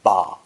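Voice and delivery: "Ba" sinking low in pitch; low dipping tone. Shown as a demonstration. Teacher demonstrating a Mandarin tone, low dipping "Ba". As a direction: say "Ba" low, with a dip in the tone. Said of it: "with a low dipping tone".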